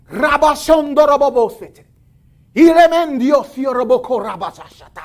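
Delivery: rapid flowing tongues syllables; speaking in tongues. Shown as a demonstration. Preacher demonstrating tongues to congregation, fluent and loud. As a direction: burst into glossolalia. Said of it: "I talk in tongues anytime I want to".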